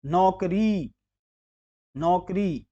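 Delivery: नौकरी said with a plain o, "nokri"; wrong vowel. Shown as a demonstration. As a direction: round नौ to a simple "no" in नौकरी. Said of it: "ये ओ की मात्रा है".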